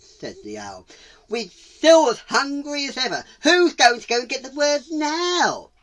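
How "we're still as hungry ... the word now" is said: loud, high owl voice; raised, high-pitched complaint; indignant owl. Acted. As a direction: demand a volunteer from the crowd. Owl demands a new volunteer.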